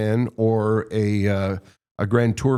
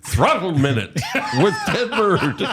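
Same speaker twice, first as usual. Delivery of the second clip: laughing; 7 semitones higher than usual. Real, not acted. Joking rename, laughing through it.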